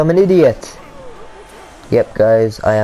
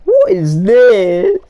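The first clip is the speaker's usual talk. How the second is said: frustrated shout; 6 semitones higher, 4 dB louder, much slower than usual. Real, not acted. Gamer reacting to play.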